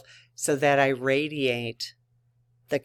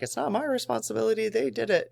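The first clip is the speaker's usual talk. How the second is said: mock denial; 8 semitones higher, much faster than usual. Mocking a blame-shifting attitude.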